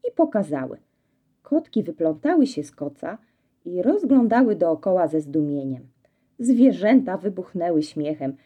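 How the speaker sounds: plain reading voice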